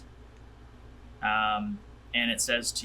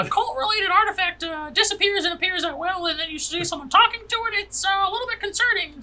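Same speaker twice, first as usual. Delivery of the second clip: high, worried character voice; high-pitched NPC voice; 4 dB louder, faster than usual. Acted. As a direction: play the wary companion up high. GM plays NPC Croc.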